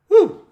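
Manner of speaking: sudden high excited whoop; loud whoop. Real, not acted. Vlogger whooping at his viewers, excited. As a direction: let out a big excited woo.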